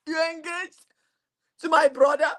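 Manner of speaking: high-pitched stunned question; mock-stunned, pitched well up; acted shock. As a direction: re-enact disbelief, rising high. Acts out shock at engagement news.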